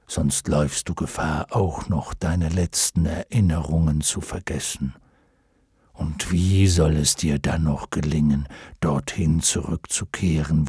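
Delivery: low, grave dragon counsel; almost solemn. A narrator's reading. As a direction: advise him in a deep, measured dragon voice. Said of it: "es klang beinahe feierlich, wie er sprach". (beinahe feierlich, mit tiefer Stimme)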